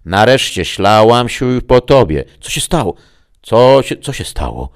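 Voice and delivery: a voice breaking up over a bad link; garbled radio voice. A narrator's reading. Greta calling Max through a failing link, garbled and high.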